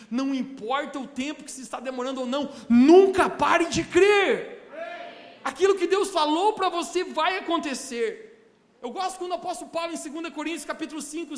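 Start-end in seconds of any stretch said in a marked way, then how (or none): 2.7-8.5 nunca pare de crer yelled, slow and much louder; full-force sermon cry; preacher's shout. Acted. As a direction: thunder it to the church. Preacher climax to congregation.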